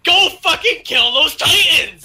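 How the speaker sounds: high, loud mock war cry; rally the troops at full tilt; hammed-up commander yelling a charge; rousing battle order. Excitedly acting out commander's charge.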